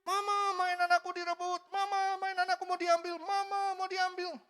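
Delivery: put-on high whining little child's voice; whiny child. Child tattling to mama that the neighbour's kid grabbed his toy, high whine. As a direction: whine to mama like a three-year-old.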